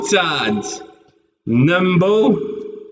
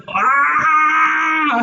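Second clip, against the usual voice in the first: pained cry; 10 semitones higher than usual. Real, not acted. Cry at bad luck.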